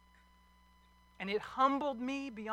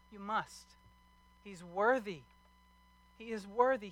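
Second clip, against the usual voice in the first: ordinary talk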